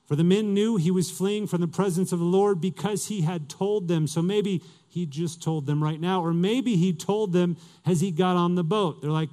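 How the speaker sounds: normal talking voice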